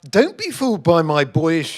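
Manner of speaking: ordinary talk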